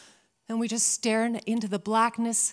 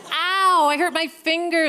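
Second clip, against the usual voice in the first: whiny little sister; 7 semitones higher, 7 dB louder than usual. Retold or imitated. Sister complains after the crash.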